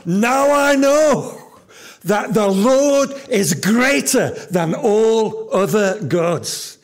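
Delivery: high, loud and slow cry of joy; preacher voicing Jethro's joyful shout; joyful exclamation. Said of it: "nearly explodes with joy and exclaims".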